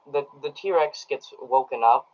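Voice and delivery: ordinary delivery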